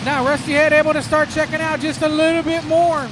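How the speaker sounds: lively live race call; motorsport commentator. Real, not acted. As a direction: call the laps as they unfold.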